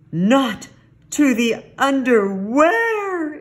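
loud, high, hammed-up horror; shocked exclamation. A narrator's reading. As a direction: exclaim it high, stretching the last word. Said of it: "exclaimed Scapito".

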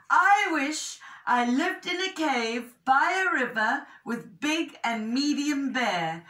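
earnest storybook cub wishing; Little Bear making a careful wish. A narrator's reading.

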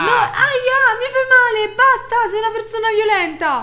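acuto e forte, mi fai male; grido di dolore. Acted. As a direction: strilla per farlo smettere. Picchiata, grida basta. (grido alto di dolore e protesta)

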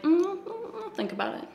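high, soft pretend pondering; mock deliberation. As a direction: play coy with a drawn-up, quiet voice. Acts out pretending to consider invitation.

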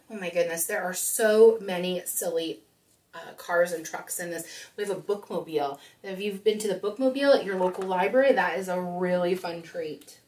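ordinary delivery

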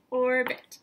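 "Orbit" said loud in two clapped beats, OR-BIT; clapped syllables. Shown as a demonstration. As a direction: clap each part of "Orbit" out loud. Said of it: "Count it".

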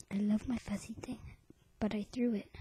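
soft secret whisper. Acted. Whispered confession.

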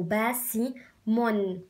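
the whole word drawn out slowly; slow blended word. Shown as a demonstration. Teacher blending the syllables slowly for children. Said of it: "لازم تعرفوا".

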